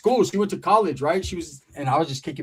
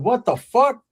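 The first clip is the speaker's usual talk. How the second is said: shocked outburst; 8 semitones higher, 3 dB louder, slower than usual. Retold or imitated. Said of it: "I said".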